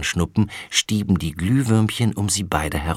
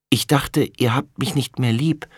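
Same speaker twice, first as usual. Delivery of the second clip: plain reading voice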